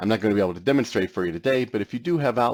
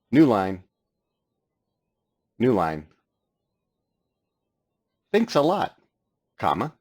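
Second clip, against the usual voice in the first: dictating; 4 semitones higher, 3 dB louder than usual. Shown as a demonstration. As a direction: speak each word clearly to the mic. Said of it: "here we go into dictation mode".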